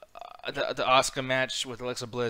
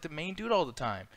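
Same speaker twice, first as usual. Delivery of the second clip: ordinary delivery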